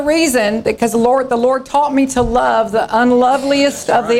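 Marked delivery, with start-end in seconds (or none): none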